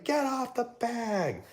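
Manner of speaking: high, held-back mock scream, drawn out; imitated screaming. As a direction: imitate a distant yell without the volume. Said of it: "and he's screaming".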